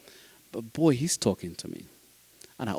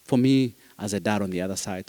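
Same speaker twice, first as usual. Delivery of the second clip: usual speaking voice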